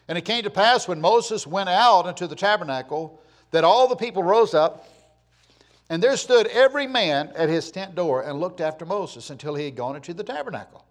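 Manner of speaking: declaimed Bible reading in strong, rising swells; preacher's scripture reading. Acted. Preacher reads God's command to Moses, scripture reading. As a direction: proclaim the verse from the pulpit.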